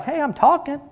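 small high-pitched plea for attention; imitating wife. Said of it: "her voice, she's kinda quiet and meek".

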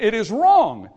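loud, high pulpit declaration; forceful condemnation. Acted. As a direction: come down hard as a preacher. Acted refusal of false doctrine.

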